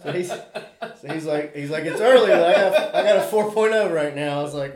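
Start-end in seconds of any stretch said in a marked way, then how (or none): 1.8-4.4 proud bragging. Retold or imitated. Son bragging about grades.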